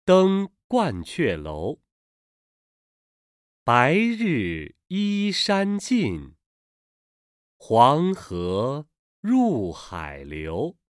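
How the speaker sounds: slow, drawn-out recital of Chinese verse; classical poem recitation. Shown as a demonstration. Reader modelling an ancient poem for learners, slow recitation. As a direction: stretch each syllable, pausing between phrases.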